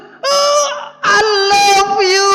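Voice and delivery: very high, slow, tearful cry of I love you; a wife overwhelmed and crying out her love; wife's tearful cry. Retold or imitated. Wife reading the surprise letter, crying out very high and slow. Wife's emotional reaction retold.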